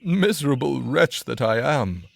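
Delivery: loud miserable lament; crying out. A narrator's reading. Carter crying over his second cask, loud lament. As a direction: cry it out in misery. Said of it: "he again cried out".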